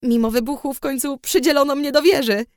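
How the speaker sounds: bursting with news about becoming an alchemist; excited. A narrator's reading. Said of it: "mówiła podekscytowana".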